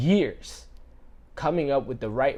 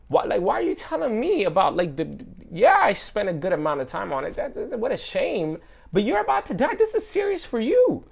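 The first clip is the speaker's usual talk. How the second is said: rising exasperation; 7 semitones higher, 5 dB louder than usual. Pretend. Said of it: "The doctor's gonna look at you like".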